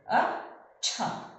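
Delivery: अच्छा stretched very slowly, च and छ drawn out. Shown as a demonstration. Teacher sounds out अच्छा for pupils, very slowly. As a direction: pull अच्छा apart so both letters ring. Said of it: "च और ये छ".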